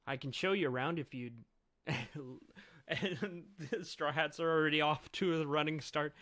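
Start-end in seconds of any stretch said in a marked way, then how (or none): none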